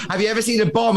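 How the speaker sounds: high, laughing through the question; can't keep a straight face. Real, not acted. Guest quoting the embassy woman while laughing.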